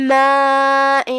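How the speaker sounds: ماء stretched long over four counts; four-count madd. Shown as a demonstration. Teacher demonstrating madd wajib, long held vowel. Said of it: "ہم اپنی آواز چار مد کے برابر لمبی کریں گے".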